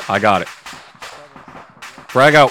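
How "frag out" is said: loud callout. Real, not acted. Throwing grenade in firefight.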